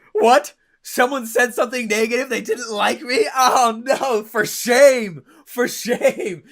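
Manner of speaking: loud, high hammed-up indignation; mock offended. Mocking his younger self taking offense, hammed-up and loud. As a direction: overplay the wounded ego. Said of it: "I would get all offended and butthurt".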